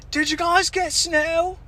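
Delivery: high, drawn-out mom impression; put-on high motherly question; mom's voice. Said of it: "That's what my mom said".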